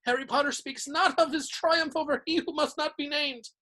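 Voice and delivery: high, hushed elf worship; reverent elf. A narrator's reading. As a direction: speak of him with awe. Dobby continues reverently.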